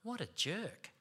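hushed, disapproving mutter in a retold story; muttering to himself. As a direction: say it under the breath, as a private thought. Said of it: "I said to myself".

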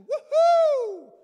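sudden high loud whoop; woohoo cheer. Acted. Preacher mocking congratulations to the church, high loud whoop. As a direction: cheer it out like a fan.

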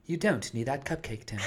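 sultry British female voice; sexy British woman voice.

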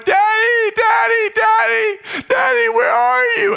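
loud, very high mock child's wail; hysterical bawling child. Lost girl calling for her father, hysterical high wail.

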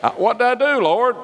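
high panicked cry to the Lord; screamed prayer. Retold or imitated. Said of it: "I screamed".

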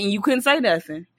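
ordinary talk, nothing marked

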